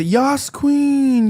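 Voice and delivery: high, drawn-out mock cheer. Mimicking how they always talk, exaggerated high cheer. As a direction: stretch it into a high-pitched hype-up. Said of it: "they always say".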